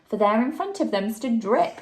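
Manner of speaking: plain reading voice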